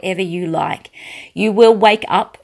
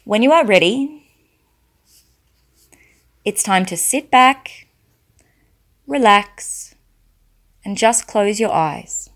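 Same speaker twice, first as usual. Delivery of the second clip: guided hypnosis; 6 dB quieter than usual. Acted. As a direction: lull them down into trance. Leads a hypnosis induction.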